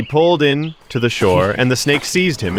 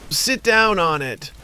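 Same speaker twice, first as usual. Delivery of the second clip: put-on impatient snake girl; 8 semitones higher, slower than usual. Snake daughter orders.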